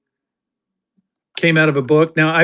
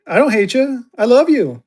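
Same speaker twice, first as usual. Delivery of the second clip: love line pitched way up; high, breathy-sounding love line; 8 semitones higher, 3 dB louder than usual. Real, not acted. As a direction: say it up high, almost crooning. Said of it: "that sounded very sexual".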